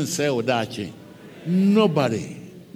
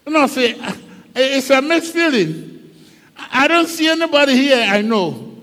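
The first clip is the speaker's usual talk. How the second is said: higher, louder, wondering outpouring; Joseph's bewildered lament; 5 semitones higher, 4 dB louder than usual. Acted. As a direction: sound lost and overwhelmed in a strange land. Joseph's bewildered inner monologue.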